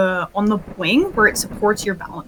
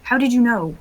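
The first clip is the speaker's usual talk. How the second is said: ordinary talk, nothing marked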